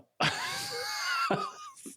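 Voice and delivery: shrieking laugh. Real, not acted. Cracking up after the impression.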